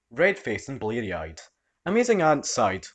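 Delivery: ordinary delivery, nothing marked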